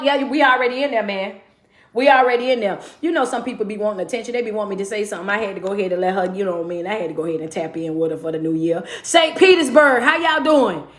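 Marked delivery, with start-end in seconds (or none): 3.0-8.8 low, soft and quick, half to himself; quiet fast aside. Real, not acted. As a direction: drop the voice and rush the gossip. Quiet low aside about someone.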